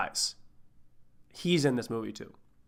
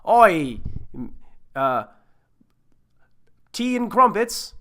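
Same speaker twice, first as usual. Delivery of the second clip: put-on loud, high, hammy British accent; an office guy badly faking British; 6 semitones higher, 6 dB louder, slower than usual. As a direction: crude British caricature, halting between words. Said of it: "at your office doing a British accent".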